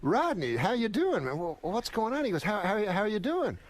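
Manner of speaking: high-pitched astonished greeting; retold shock. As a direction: imitate a friend's stunned disbelief, pitch jumping up. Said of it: "he was, like, completely shocked".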